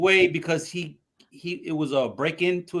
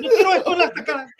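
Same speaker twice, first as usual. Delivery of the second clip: imitating mom; 12 semitones higher, 6 dB louder, much faster than usual. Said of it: "be like".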